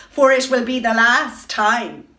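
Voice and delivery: loud cruel taunt; jeering. Acted. Queen taunts the doomed swans, loud and cruel. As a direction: twist the knife, loudly. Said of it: "she jeered".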